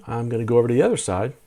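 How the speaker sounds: usual speaking voice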